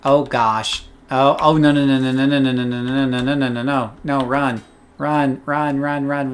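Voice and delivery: frantic no no no, run; yelling while running away. Real, not acted. Fleeing a monster in game.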